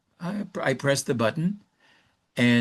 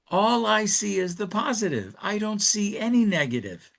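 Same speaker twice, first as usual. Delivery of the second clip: higher, lightly voiced wife; a hint of the wife's voice, pitched up; voicing the wife; 8 semitones higher than usual. Wife answers husband on the phone, lightly voiced and higher. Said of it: "So she says to him".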